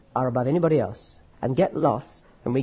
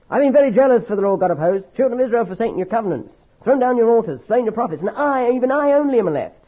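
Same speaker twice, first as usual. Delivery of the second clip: high, whining complaint of a sulking prophet; petulant prophet pouring out his grievance; self-pitying complaint; 7 semitones higher, 4 dB louder than usual. Shown as a demonstration. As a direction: read it as a wounded, self-pitying grumble. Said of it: "Do you see how I read it?".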